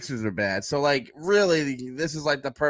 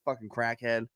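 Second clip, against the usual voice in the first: muttered insult; 4 semitones lower, 7 dB quieter than usual. Retold or imitated.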